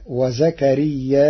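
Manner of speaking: "وزكرياء" with long a, then a glottal stop and short a; hamza after alif. Shown as a demonstration. Teacher showing how some Arabs say it, hamza after alif. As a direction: close "وزكرياء" with a voweled hamza. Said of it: "بهمزة منصوبة بعد الألف".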